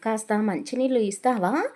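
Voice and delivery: loud menacing order for water; husband loudly threatening his child bride; threatening call. A narrator's reading. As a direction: bark at the frightened young wife. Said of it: "గట్టిగా సంతోషిని బెదిరిస్తూ అడిగాడు".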